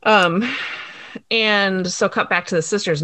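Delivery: ordinary delivery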